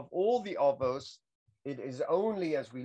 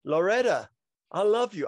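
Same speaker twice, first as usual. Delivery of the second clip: Nicolas Cage line; 4 semitones higher, 5 dB louder than usual. Retold or imitated. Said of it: "I can't put on Nicolas Cage's accent".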